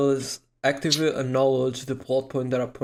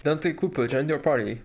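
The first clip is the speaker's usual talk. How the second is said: usual speaking voice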